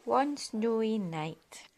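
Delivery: slow storybook opening; reading to a child at bedtime; story opening. A narrator's reading. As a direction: draw the listener into the tale.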